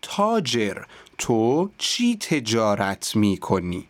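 slowly and carefully, each sound clear; slow drill. Shown as a demonstration. Teacher reading the practice dialogue slowly. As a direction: chew through every consonant of تاجر. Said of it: "این چند ترکیب کلمه".

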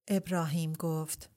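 ordinary delivery

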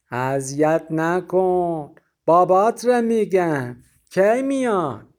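prying Azeri neighbour; Azeri accent. A narrator's reading. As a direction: pester in a thick Azeri accent. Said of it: "با لهجهٔ غلیظ آذری".

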